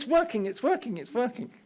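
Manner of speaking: breathless good news, called after someone; excited. Retold or imitated. Tutor chasing student, device fixed.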